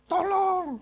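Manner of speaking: high, loud cry of Tolong; shouting for help. Retold or imitated. Man stuck on a branch calls for rescue, high loud shout. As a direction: yell for help into the dark. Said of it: "dia mulai berteriak".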